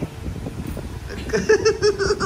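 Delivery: unvoiced high laugh; breathy real laugh. Real, not acted. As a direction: laugh almost silently at the dog. Dog fights toy. (बिना आवाज़ की हँसी)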